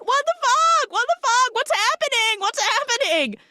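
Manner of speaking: very high, loud panicked shrieking; hammed-up panic. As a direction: freak out in terror. [name] panicking.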